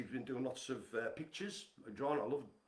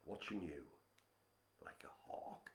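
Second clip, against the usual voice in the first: whisper; 9 semitones lower, 14 dB quieter than usual. Pretend. Mock menace to a friend.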